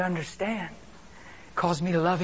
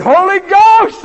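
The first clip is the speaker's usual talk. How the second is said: high, drawn-out retold holler; big exultant yell; loud shout; 12 semitones higher, 18 dB louder, much slower than usual. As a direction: shout it slowly at full volume. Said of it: "I shouted real loud".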